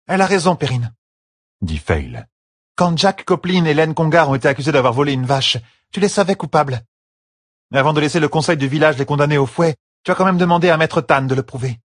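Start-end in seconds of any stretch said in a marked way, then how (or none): 0.1-0.9 high-pitched voice of the wife; Fail, gently insistent. Acted. Fail sides with the sister against Perrine, high and insistent. 2.8-11.8 high-pitched voice of the wife reasoning; Fail, gently insistent. Acted. Fail reminds Perrine of a past case, high and insistent.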